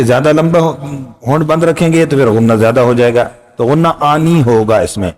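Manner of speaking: normal talking voice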